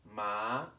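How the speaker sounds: "ma" rising from the middle up; "ma" with a rising second tone. Shown as a demonstration. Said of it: "starts mid-range and goes up".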